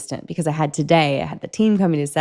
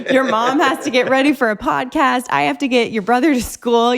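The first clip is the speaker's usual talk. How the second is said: hurried dad; 6 semitones higher, 3 dB louder than usual. Retold or imitated. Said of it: "Chris is like".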